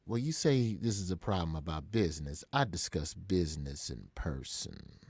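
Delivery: low, measured, unhurried delivery. Retold or imitated. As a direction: take your time, soft and in control. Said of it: "he speaks real slow".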